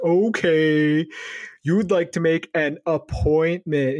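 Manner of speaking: slow, mocking repeat-back; condescending. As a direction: humour the caller with audible air quotes. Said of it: "totally being condescending".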